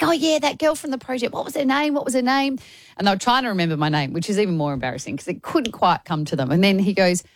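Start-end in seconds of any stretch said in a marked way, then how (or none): 0.0-2.6 imitating excited strangers. Imitating excited strangers recognising her.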